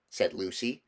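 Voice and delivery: ordinary delivery, nothing marked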